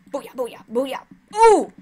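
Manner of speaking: booyah chant rising to a loud ooh; victory cheer. Real, not acted. Gamer cheering a kill, rising to a loud ooh. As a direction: crow over the kill.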